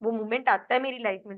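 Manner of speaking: ordinary delivery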